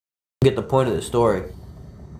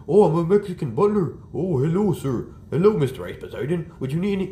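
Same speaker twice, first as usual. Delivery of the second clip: mock Mexican butler; 5 semitones higher than usual. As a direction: ham up a Mexican butler accent. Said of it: "The guy who talks like this".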